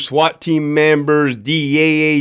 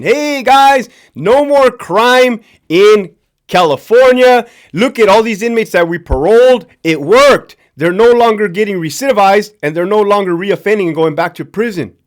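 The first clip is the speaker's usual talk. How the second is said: loud, high mock politician boast; mocking Newsom; 4 semitones higher, 4 dB louder than usual. Mocking the governor's boast to voters, loud and high. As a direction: ham up a smug governor bragging. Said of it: "Gruesome Newsom, saying".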